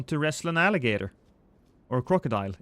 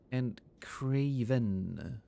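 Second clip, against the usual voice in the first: breathy unvoiced whisper, slow; 4 semitones lower, 8 dB quieter, much slower than usual. Real, not acted. Streamer greeting a chat user, slow whisper. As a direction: breathe the name out slowly.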